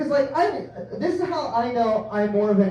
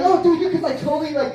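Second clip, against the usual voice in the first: eager; 9 semitones higher, 5 dB louder than usual. Retold or imitated. Eager to joke.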